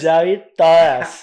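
loud, drawn-out mock of an excuse; mocking incredulity. Friend mocking a date's cinema excuse, loud incredulity. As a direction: throw the excuse back loudly and slowly.

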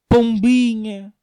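slow, high, stretched word; drawn-out coo. Acted. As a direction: croon it like a dove. (palavra arrastada e aguda)

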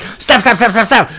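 high, rapid pleading; mock pleading. Voicing victims begging, high and quick. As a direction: beg in a panicked pitch.

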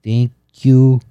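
halting foreign-accented English, mock; broken English. Imitating a visitor to show learners try, mock broken English. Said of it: "they had a very bad broken".

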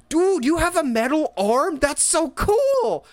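put-on high, slow, amazed teen hero voice; excited Spider-Man. Fan imitating Spider-Man gushing over a metal arm, high and amazed. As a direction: gush like a starstruck kid.

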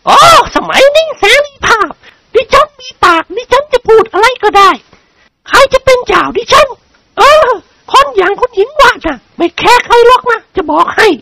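high, defiant noblewoman; defiant lady. A narrator's reading. (คุณหญิงเสียงสูงท้าทาย)

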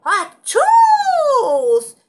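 huge sneeze burst out high and loud. Shown as a demonstration. Narrator showing her sneeze, full loud achoo. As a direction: let the sneeze out big and clear. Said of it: "lo dejo salir fuerte y claro".

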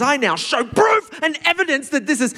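mock worked up. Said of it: "really worked up".

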